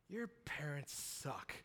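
low, soft muttered aside under the breath; a quiet slow grumble; muttered thought. Retold or imitated. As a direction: say it to yourself, barely aloud. Thought kept silent about parents.

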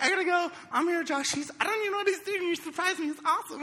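high, rushed, giddy; flustered girlfriend. Retold or imitated. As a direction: blurt it out high and fast to her friend. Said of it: "she's like".

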